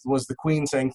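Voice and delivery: usual speaking voice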